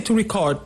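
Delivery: record with the weight on CORD; verb stress. Shown as a demonstration. Teacher showing the verb form, second-syllable stress. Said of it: "stress is on the second syllable".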